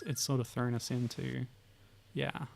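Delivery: ordinary delivery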